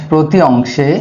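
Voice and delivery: word by word, long gaps between phrases; slow dictation. Real, not acted. Teacher dictating the vowel rule to students, slow dictation. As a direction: dictate slowly so it can be written down.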